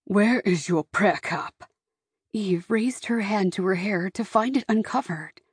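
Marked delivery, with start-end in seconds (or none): 0.0-1.7 slow, stern questioning; measured, reproachful father's voice; angry father. A narrator's reading. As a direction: demand it slowly, with contained anger. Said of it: "but in anger".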